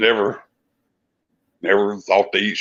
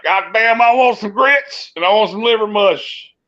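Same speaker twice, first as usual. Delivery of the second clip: put-on much higher, demanding drunk whine; drunken rant; 10 semitones higher than usual. Retelling his late-night rant to bandmates, high drunk whine. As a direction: whine like a drunk at two in the morning. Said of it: "I'd get on one of them drunk rants".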